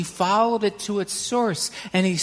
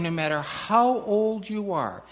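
ordinary delivery, nothing marked

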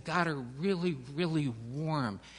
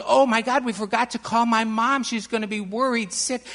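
put-on high, loud, alarmed child; panicked realization; 8 semitones higher, 11 dB louder than usual. A narrator's reading. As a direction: blurt it out in a rush of panic. Annie remembers her mom.

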